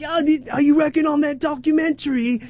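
high mocking squeal; high, put-on showbiz small talk; douchey LA partygoers. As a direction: imitate vapid LA party chatter. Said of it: "saying all these douchey L A".

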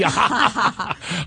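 deliberate laugh breaking into a real one; demonstrated laugh. Shown as a demonstration. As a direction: turn the groan into laughter. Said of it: "your doubts are gonna be".

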